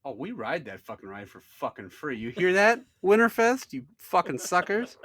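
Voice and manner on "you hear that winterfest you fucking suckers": loud, high mock taunt; triumphant, playful jeer, slowing on the insult; gloating call-out. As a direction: crow it out at an absent crowd. Gloats, calling out to Winterfest.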